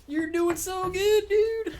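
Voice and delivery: high, slow sing-song praise. Real, not acted. Streamer cooing praise to his recovering dog, high and slow.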